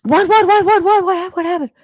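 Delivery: high, loud panicked questions; alarmed mom. Retold or imitated. Mom rushing to crying daughter, panicked and high. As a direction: rush in frightened, asking over and over. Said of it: "And the mom ran over".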